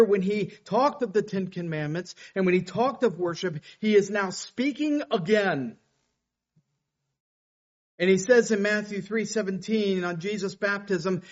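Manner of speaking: fiery pulpit preaching; preaching at full force. Acted. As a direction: preach it out to the whole room. Preacher builds to a climax.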